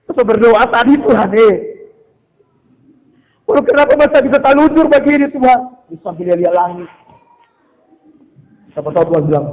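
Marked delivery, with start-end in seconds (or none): 1.0-5.6 loud protest to God, climbing higher; acted angry complaint. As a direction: play the exasperated tahu seller praying crossly. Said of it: "Dia pulang sambil marah-marah". (keluhan kesal kepada Tuhan, lantang)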